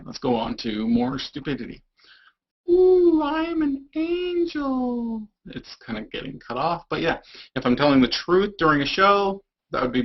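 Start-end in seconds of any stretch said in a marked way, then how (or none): 2.7-5.3 high, slow, sweet angel voice. Pretend. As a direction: float the words like a heavenly angel. Angel face filter on camera.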